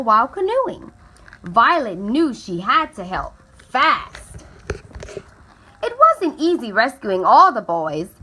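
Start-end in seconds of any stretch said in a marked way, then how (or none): none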